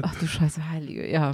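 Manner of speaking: cursing under breath. Real, not acted. Realising Silvester is near.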